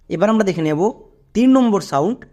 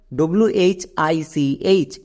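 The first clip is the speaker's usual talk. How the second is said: slow spelling out; much slower than usual. Shown as a demonstration. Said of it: "একসঙ্গে উচ্চারণ করা যাক".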